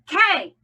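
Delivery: K said loud and high; accented letter. Shown as a demonstration. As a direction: punch K. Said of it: "accent every other letter".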